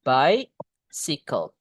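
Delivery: BICYCLE slowly, "BAI-si-kol"; model pronunciation. Shown as a demonstration. Teacher showing how it is read, slow drawn-out BICYCLE. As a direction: stretch out BICYCLE, short "si" in the middle. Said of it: "Ingat bacanya gini".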